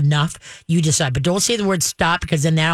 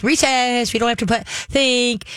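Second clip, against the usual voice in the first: high giddy dog voice; excited dog voice; 7 semitones higher than usual. Retold or imitated. Trainer voicing the overexcited dog. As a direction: play the dog thinking it's recess. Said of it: "that it's like".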